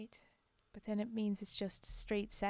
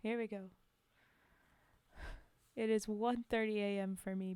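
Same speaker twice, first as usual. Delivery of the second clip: ordinary delivery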